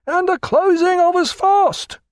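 high, loud call from the masthead; lookout hailing. Acted. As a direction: call down from aloft, unhurried. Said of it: "replied the lookout".

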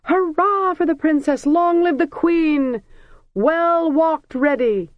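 high, loud cheering shouts, long live drawn out slowly; crowd cheering. A narrator's reading. Harbour crowd hailing the princess, high cheering shouts. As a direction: cheer her like a joyful crowd. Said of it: "the crowds burst out".

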